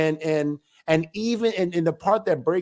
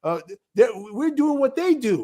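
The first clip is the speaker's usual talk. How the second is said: hammed-up "hey, look at this!" voice; imitated shocked realization; 8 semitones higher than usual. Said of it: "They'll say".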